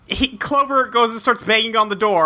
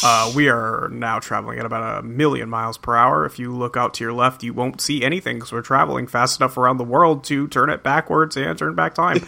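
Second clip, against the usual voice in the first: pilot announcement voice; 8 semitones lower than usual. Retold or imitated. Continues the pilot announcement bit.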